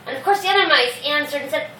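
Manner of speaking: ordinary delivery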